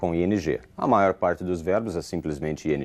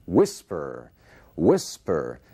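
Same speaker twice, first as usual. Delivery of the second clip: strong WHIS, falling away on -per in whisper; stress on the first syllable; 3 semitones lower, much slower than usual. Shown as a demonstration. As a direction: whisper with WHIS punched, voiced normally. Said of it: "está na primeira parte".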